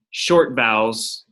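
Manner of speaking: short vowels drawn out slowly, stress on short; slow emphasis. Shown as a demonstration. Teacher spelling out the fourth rule to students. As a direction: lean on short and take your time. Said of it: "teacher stressing the rule's term".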